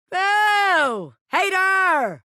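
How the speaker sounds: high playful jeer; mock booing. Bobby Pin booing the recruit as a hater. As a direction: heckle them for saying no.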